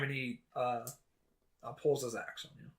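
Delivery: normal talking voice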